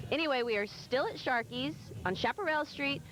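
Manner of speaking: normal talking voice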